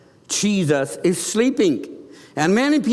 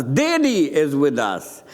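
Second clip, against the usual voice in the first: ordinary talk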